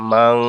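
"মাং" ending in a held nasal hum; stretched nasal close on "মাং"; nasal ghunnah. Shown as a demonstration. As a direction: let the ং of "মাং" ring through the nose. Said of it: "অন্য সুকার".

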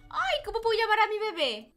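very high, loud gushing; excited squeal. Real, not acted. Player gets to name the baby, squealing with excitement. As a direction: gush it at a squeal.

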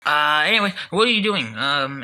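ordinary talk, nothing marked

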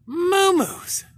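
very high, loud delighted call; squealing cat greeting; excited shout. Real, not acted. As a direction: yell the cat's name joyfully.

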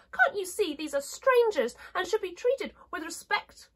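high, indignant princess voice; high-pitched storybook reproof; scolding china princess. Acted. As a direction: reprove the clown in a high doll voice.

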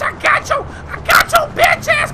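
gloating shout. Pretend. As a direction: crow over a caught opponent. Taunting someone caught in game fight.

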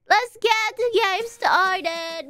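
slow, drawn-out game-start cheer; long playful kickoff; hyped-up announcement. Real, not acted. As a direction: stretch it out like a hype call.

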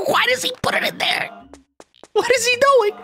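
very high squeak of disbelief; squeaky disbelief. Real, not acted. The player baffled by the kid collecting poop.

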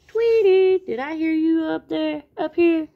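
loud, high cartoon cat call. A narrator's reading. Sylvester calling up to Tweety, high loud call. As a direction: call high and long up to someone out of sight. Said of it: "Soon he heard Sylvester call".

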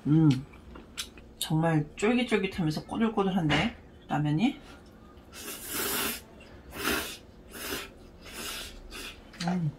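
chewing while talking; eating noodles while speaking; mouth full of noodles. Real, not acted. Seen 0: Slurped noodles just before; lips nearly closed while talking over noodles.

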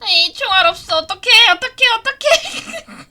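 high, loud panicked cry; panicked shriek. Real, not acted. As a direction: yelp in panic. Panics out of ammo. (당황한 비명)

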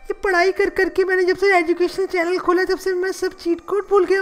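ordinary talk

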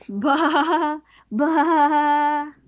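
ordinary delivery

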